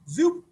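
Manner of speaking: "zoop" jumped up high; playful high zoop; high sound effect. Acted. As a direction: squeak it up like a sock sliding on. Sound effect while reading aloud.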